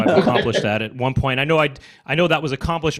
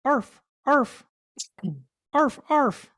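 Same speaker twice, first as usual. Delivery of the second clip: high yappy dog barks; dog impression; 12 semitones higher, much slower than usual. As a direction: bark like a small dog. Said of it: "This is my impression of a dog".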